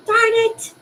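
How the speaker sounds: sharp high cry of frustration; pained outburst. Real, not acted. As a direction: blurt it out as the toe throbs. Pain flares, exclaiming.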